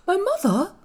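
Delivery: high, surprised question; gasp, then ask in astonishment; startled. A narrator's reading. Colin startled to hear of mother.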